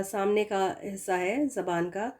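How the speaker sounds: ordinary talk, nothing marked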